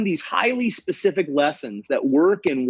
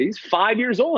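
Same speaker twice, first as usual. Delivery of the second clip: parent; 9 semitones higher than usual. Retold or imitated. Parent gives child's age.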